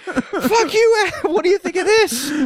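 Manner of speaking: high mock-angry taunt; spiteful. To Bill, a high mock-angry taunt. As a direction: retaliate with glee.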